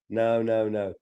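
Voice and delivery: normal talking voice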